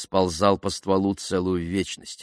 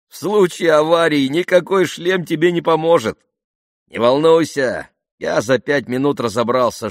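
high, loud, carefree assurance; amused bravado; 8 semitones higher, 6 dB louder than usual. A narrator's reading. Jeremy reassuring nervous passenger Ben, amused bravado. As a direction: brush off the danger merrily. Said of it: "Джереми рассмеялся".

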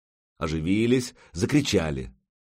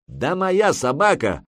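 higher, louder, stretched-out protest; indignant retort; 9 semitones higher, 5 dB louder, slower than usual. A narrator's reading. Warrior retorting to teasing about his dog, indignant. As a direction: push back hard, drawing the words out.

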